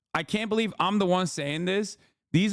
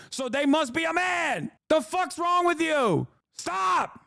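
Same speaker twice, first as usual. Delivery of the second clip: high-pitched, building angry tirade; exasperated rant; 6 semitones higher than usual. Real, not acted. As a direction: scold them with rising outrage. Exasperated rant at outraged right-wingers.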